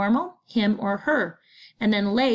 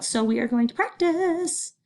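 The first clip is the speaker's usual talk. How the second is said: bright announcement; 8 semitones higher than usual. Real, not acted. Teacher announces practice cheerfully.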